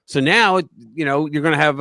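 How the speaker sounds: ordinary talk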